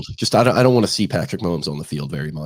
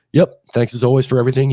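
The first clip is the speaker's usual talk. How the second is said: louder and higher, a burst of energy; loud sign-off; 6 semitones higher, 7 dB louder than usual. Real, not acted. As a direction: fire off the thank-you loudly.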